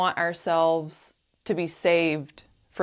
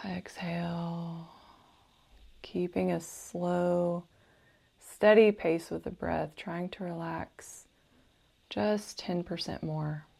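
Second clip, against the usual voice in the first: guided meditation; 4 dB quieter than usual. Acted. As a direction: gently guide the listener into calm, unhurried and soothing.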